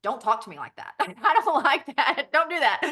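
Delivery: half-laughing mock reprimand; mock rude. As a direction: tell it off jokingly. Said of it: "I'm kinda rude to it".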